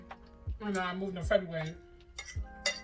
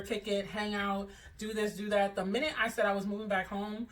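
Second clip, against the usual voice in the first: usual speaking voice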